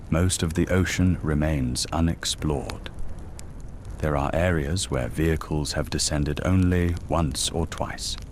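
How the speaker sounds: plain reading voice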